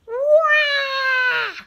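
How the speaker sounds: very high, loud crying wail; baby wail. A narrator's reading. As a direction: howl like a hungry baby. Baby wails for food.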